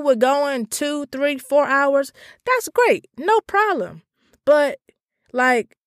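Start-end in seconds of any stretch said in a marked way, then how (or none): 2.4-4.0 mock cheerful. Sarcastic approval.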